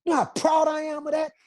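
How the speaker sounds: nearly shouting how proud he is; proud outburst. Real, not acted. To the interviewer about his passport, a proud near-shout.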